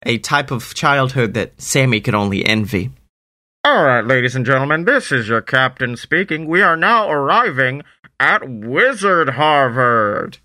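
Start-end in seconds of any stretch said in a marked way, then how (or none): 3.6-10.4 train captain announcement. Acted. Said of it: "without announcement from the captain".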